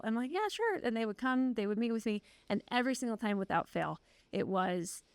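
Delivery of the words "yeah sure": chipper yes. Retold or imitated. Agreeing to students' request.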